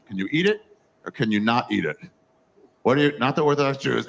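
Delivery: ordinary talk, nothing marked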